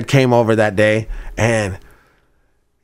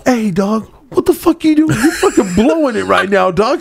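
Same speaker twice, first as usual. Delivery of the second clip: high mock scolding, raised pitch; scolding the friend who is freaking out in the drive-thru, pitched high; 7 semitones higher than usual. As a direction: snap at your buddy for blowing it.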